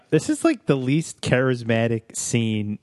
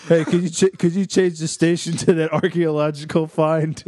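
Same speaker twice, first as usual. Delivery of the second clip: raised, louder hammed-up request; put-on eager customer, pitched up; mock bar patron; 5 semitones higher, 3 dB louder than usual. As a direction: call to the bartender in mock eagerness, laughing at the end.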